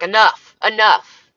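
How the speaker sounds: loud, firm judge cutting someone off; judge's stern shout. Retold or imitated. Retelling how the judge silenced the angry man, loud and firm. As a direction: bark it like a judge ending an argument. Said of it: "the judge was like".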